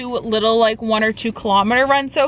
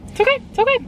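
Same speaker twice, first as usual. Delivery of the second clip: much higher, sing-song it's okay; mock self-soothing; 13 semitones higher, much faster than usual. After losing the stool, a high mock self-comfort. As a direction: talk yourself down in a high little voice. Consoling herself over lost stool.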